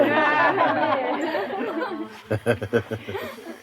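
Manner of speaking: a bright, high burst of laughter. Real, not acted. Teacher delighted by Konju's answer, high laugh.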